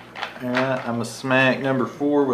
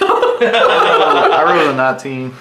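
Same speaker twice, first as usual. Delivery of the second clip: louder, slower, laughing through it; laughing excitedly; 5 semitones higher, 12 dB louder, slower than usual. Real, not acted. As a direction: brag about the roll through laughter. Shows off a high roll.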